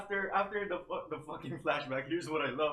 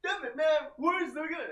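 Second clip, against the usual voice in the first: high excited yell; 12 semitones higher than usual. Retold or imitated. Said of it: "voicing the friend".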